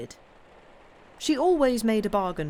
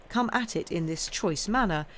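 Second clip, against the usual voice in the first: ordinary delivery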